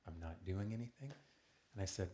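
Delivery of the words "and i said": ordinary delivery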